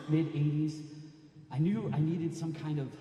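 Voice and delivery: ordinary delivery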